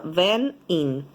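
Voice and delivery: normal talking voice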